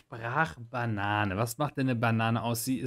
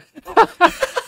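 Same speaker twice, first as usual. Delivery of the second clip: high burst of laughter; 11 dB louder than usual. Real, not acted. Laughing at own example.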